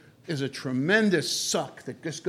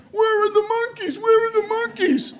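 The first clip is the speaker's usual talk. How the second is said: much higher, louder calling out for the monkeys; mock frantic shout; 4 dB louder than usual. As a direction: yell it like a lost tourist hunting monkeys. Tourists searching for the monkeys.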